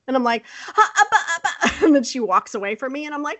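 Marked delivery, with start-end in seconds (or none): 0.8-1.7 high, sputtering speechless syllables; high mock stammer with a laugh; flustered stammering. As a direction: sputter like a kid lost for words. Imitates speechless child stammering.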